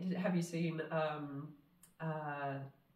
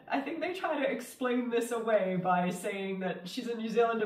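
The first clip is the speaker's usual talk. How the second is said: usual speaking voice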